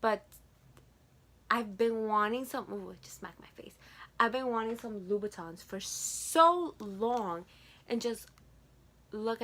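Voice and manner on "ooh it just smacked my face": fast, softer whisper aside; mutter-whisper the mishap quickly. Real, not acted. Vlogger whispering after the shoe hits her face.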